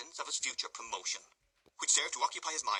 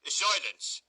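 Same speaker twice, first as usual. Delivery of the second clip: loud, stern command; stern cry; 6 semitones higher, 8 dB louder than usual. A narrator's reading. Beadle silencing the squabbling pair, stern and loud. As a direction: bark for silence. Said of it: "cried Mister Bumble sternly".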